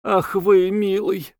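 light, high-pitched fond exclamation; a touch of amusement, voice lifted high; amused tenderness. A narrator's reading. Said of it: "смеясь, она сказала".

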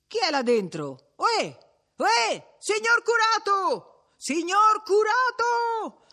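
high, long-drawn hailing shouts; crowd calling out. A narrator's reading. Villagers hailing the curate at his window, calling out. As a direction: holler up at a closed house. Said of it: "si sentì chiamare a voce di popolo".

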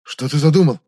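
worried. A narrator's reading. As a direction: ask in rising alarm. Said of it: "забеспокоился узник".

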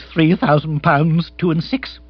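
flustered aristocrat naming a sum; agitated lord. Acted. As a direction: state the figure in a fluster. Bill states the debt, agitated.

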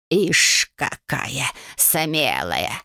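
low, slow croaking hag; old witch voice. A narrator's reading. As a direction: croak it like a greedy crone.

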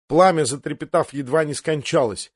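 plain reading voice

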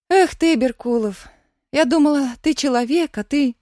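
put-on disappointed, upset girl's voice; reproachful girl. A narrator's reading. Upset girl reproaches departing friend.

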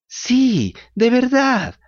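high, squeaky echoing cat. A narrator's reading. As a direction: chime in like a blind cat. Cat echoes.

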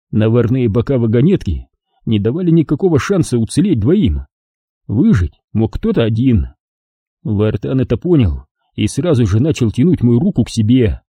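usual speaking voice

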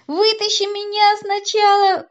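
высокая громкая протяжная мольба; умоляй жалобно, растягивая слова. A narrator's reading. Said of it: "взмолился Вовка". (жалобно молить)

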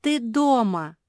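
high, loud protest; group shout. A narrator's reading. Family insists Куки is already home, shouting. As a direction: cry out insistently for everyone. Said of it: "закричали все".

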